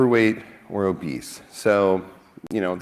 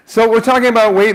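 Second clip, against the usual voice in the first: out of breath; 10 semitones higher, 6 dB louder than usual. Real, not acted. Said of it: "I actually am a little out of breath".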